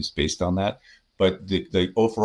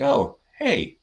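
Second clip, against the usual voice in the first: high, sing-song fake friendly greeting; mock cheery hello; 12 semitones higher, slower than usual. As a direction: greet her with sugary false cheer.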